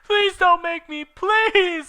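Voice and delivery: very high, loud desperate plea; Ebony begging the villain. Acted. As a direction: beg for mercy. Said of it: "I begged".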